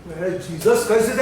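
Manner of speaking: ordinary talk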